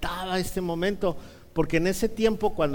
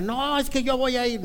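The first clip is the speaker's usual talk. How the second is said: put-on high whining little-boy plea; mock tearful protest; 9 semitones higher than usual. Boy begging mom to take him, mock tearful. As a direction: imitate a crying child begging to go. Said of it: "yo empecé a llorar y dije".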